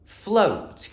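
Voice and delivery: "float" as one whole word, high and falling; blended word. Shown as a demonstration. Teacher showing children how to read, sounds blended. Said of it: "Blend it together".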